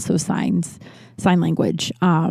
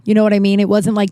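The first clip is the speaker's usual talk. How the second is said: ordinary talk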